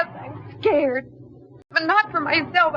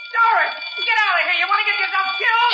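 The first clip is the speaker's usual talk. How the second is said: urgent shout; 3 semitones higher, faster than usual. Acted. Jimmy yells at Doris to get out of the line of fire, urgent shout. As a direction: yell at her to get clear, fast.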